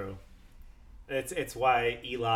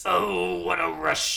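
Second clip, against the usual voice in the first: wrestling promo; 7 semitones higher, 3 dB louder, slower than usual. Retold or imitated. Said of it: "the wrestler voice".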